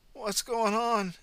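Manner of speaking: high, slow, confused cry; dazed ambulance officer. A narrator's reading. The ambulance officer waking up confused, high and slow. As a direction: blurt it out lost and confused. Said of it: "he blurted".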